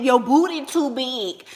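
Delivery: normal talking voice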